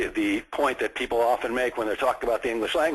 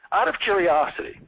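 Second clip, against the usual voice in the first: higher-pitched, casual wondering; genuinely curious; 6 semitones higher than usual. Shown as a demonstration. Salesman softening a question for a prospect, genuinely curious. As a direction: toss it in as if it just occurred to you. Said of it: "you have to say them like you really are curious".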